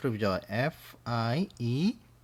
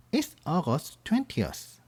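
very slow, with "twentieth" spoken as a soft "wentias"; slow model answer; 3 dB quieter, much slower than usual. Shown as a demonstration. Teacher answers the birthday question slowly for the students. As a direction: stretch every word for the class. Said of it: "선생님이 대답해 줄게요".

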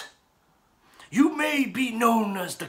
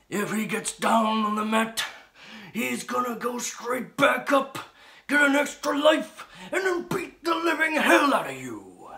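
building to a shout; 8 semitones higher, 4 dB louder than usual. Acted. Promo threat building up.

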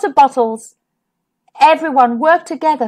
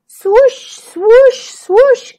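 high rising swoosh of waves; playful wave noises; wave swooshes; 10 semitones higher, 5 dB louder, much slower than usual. A narrator's reading. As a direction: sweep the words up like waves rolling in. Imitating wave sounds in story.

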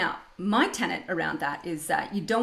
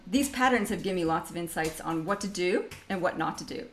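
ordinary talk

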